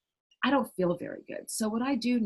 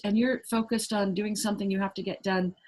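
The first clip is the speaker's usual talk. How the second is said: usual speaking voice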